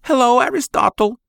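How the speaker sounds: high, sweet mother's greeting. Acted. Mother greets her son sweetly in a high voice. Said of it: "she replied".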